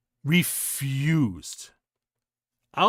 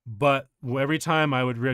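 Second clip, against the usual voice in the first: ordinary talk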